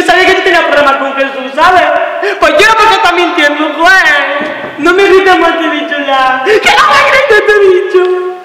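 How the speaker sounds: high shout climbing to a cry; screaming protest. Acted. Partner screaming not to be shouted at, desperate and furious. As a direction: build from a high yell into a stretched scream. Quarrel peaks, don't shout at me.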